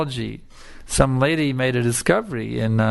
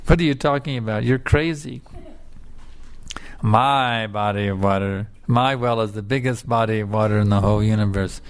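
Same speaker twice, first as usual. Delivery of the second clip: put-on proud old frog; 5 semitones lower than usual. Old Doctor Frog scoffs at his student, low and pompous.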